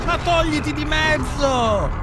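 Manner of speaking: high slow furious yell; angry shout. Real, not acted. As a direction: shout her out of the way. Yelling at Eileen blocking way.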